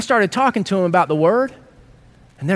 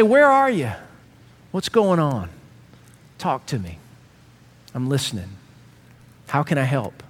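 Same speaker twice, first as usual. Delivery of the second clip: tender invitation; 6 semitones lower than usual. Acted. Caring words to struggling person.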